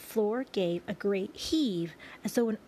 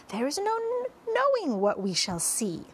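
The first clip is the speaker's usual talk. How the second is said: high, eager exclamation; excited cry; 7 semitones higher, 4 dB louder than usual. A narrator's reading. Centipede answering the ladybug about wonders ahead, excited cry. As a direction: burst out with wonder. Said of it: "cried the centipede".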